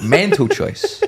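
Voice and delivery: put-on "Mental choice" high, slow and laughing in Edinburgh accent. Repeating "Mental choice" to the co-host, high Edinburgh accent. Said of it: "Edinburgh accent".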